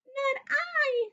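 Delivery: very high tiny mouse voice; squeaky mouse. Acted. Little mouse refusing the hen, very high and squeaky.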